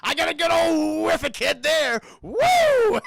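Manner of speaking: big rowdy holler; exuberant whoop. Acted. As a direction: belt it out and finish on a soaring whoop. Exuberant acted line while recapping.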